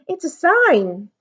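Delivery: slow, high, emphatic announcement; slow high excited call; excited shout. A narrator's reading. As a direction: lean on sign, thrilled. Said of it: "shouted Sam".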